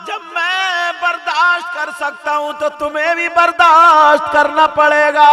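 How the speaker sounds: ringing, sustained preacher's chant; full-force preaching. Retold or imitated. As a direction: preach it at full volume, stretching key words.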